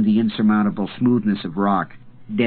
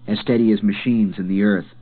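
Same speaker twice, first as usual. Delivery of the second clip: plain reading voice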